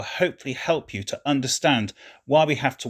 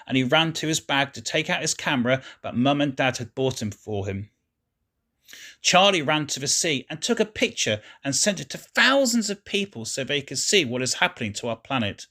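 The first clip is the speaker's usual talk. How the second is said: plain reading voice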